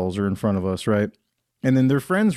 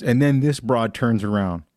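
normal talking voice

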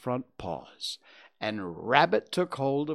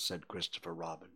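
plain reading voice

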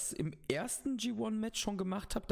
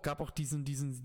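ordinary delivery

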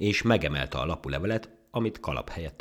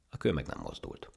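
usual speaking voice

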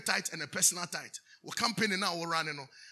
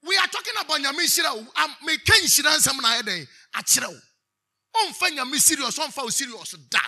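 loud, high-pitched preacher's exhortation; raised, forceful sermon delivery; impassioned preaching; 5 semitones higher, 8 dB louder than usual. Real, not acted. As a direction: drive it home to the congregation at full force.

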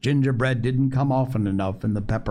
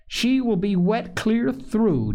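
higher, drawn-out motherly dismay; mild alarm; 9 semitones higher, slower than usual. A narrator's reading. Mother seeing the child soaked, mild alarm. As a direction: exclaim it slowly with mild alarm.

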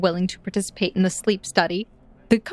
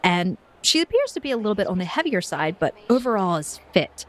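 usual speaking voice

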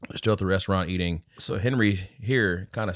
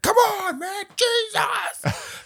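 angry dad shout; much slower than usual. Retold or imitated. Soccer dad yelling at the referee, high mock shout. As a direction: yell like a furious sideline parent. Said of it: "one dad's like".

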